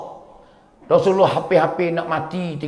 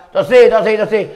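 higher, louder quick refusal; acted insistent refusal; 4 semitones higher, 5 dB louder, faster than usual. Bilal refuses the pleading boys, acted insistent refusal. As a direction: cut in with a burst of refusals.

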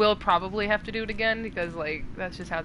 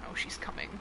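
whispering; 8 dB quieter than usual. Real, not acted. Hiding from monster, whispers warning.

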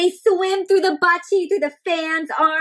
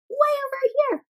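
excited pointing; 11 semitones higher than usual. Acted. Pointing out Oba again.

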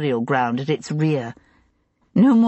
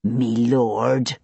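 mockingly; 6 semitones lower than usual. A narrator's reading. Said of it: "then added mockingly".

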